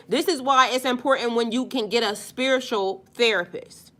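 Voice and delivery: normal talking voice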